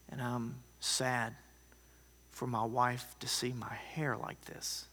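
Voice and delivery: whispered confession. Retold or imitated. Old man's sad confession.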